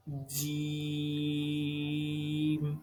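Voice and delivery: جیم drawn out very long, pressed and squeezed, with a bounce at the end; slow tajweed demo of جیم, tongue held against the palate; exaggerated jeem. Shown as a demonstration. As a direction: hold جیم long under pressure, then release it. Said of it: "exaggerated بھی ہوتے ہیں اور اس کو ہم ذرا pressurize ہو کے پڑھتے ہیں".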